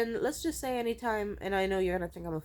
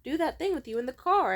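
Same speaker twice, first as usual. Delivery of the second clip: higher, louder inner voice butting in; pointed reminder, pitched up; nagging intuition; 6 semitones higher, 5 dB louder than usual. Acted. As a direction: play intuition raising an objection. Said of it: "my intuition would be like".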